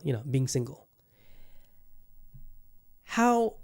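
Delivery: ordinary talk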